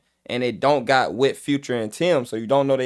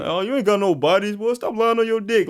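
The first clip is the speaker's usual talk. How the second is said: imitated freshman trash talk, higher pitch; raised-pitch mock locker-room taunt; teasing teenage boys; 6 semitones higher than usual. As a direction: rib a buddy like a high schooler. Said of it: "getting on each other".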